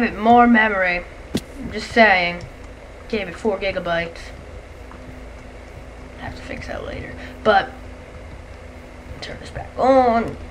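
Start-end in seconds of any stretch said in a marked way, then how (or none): 6.2-7.1 soft whispered aside. Real, not acted. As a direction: whisper it under your breath. Aside note to self.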